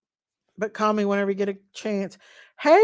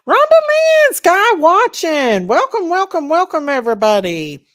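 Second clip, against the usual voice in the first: bursts out high and loud, welcoming; excited welcome; 6 semitones higher, 8 dB louder than usual. Real, not acted. Host greeting arriving viewers on her live, loud and excited. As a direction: call out the names like a happy host.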